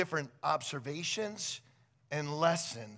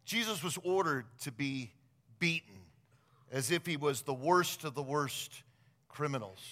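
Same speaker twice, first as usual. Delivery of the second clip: ordinary delivery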